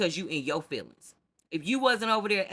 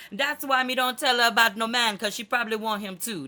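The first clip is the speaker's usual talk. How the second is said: imitated Jamaican accent, higher and louder; 5 semitones higher, 4 dB louder than usual. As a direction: put on a mock Jamaican accent for the gossip. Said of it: "don't come for me for my accent".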